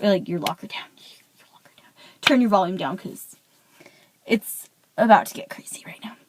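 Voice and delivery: hushed conspiratorial whisper to the viewers. Pretend. Whispering to her viewers before the big reveal, hushed. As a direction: whisper it like a secret before the drama. Jokes about turning volume down.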